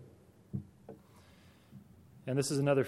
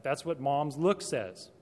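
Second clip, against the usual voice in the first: ordinary delivery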